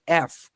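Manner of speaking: letter "F" said as English "ef"; E sound first. Shown as a demonstration. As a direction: begin "F" on an E vowel. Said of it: "escuches en la pronunciación una E". ("F" pronunciada con una E inicial)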